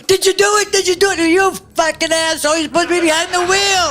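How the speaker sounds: high, panicky imitation of the getaway driver; mock panicked driver yelling at the robber; freaking out. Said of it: "He's freaking out".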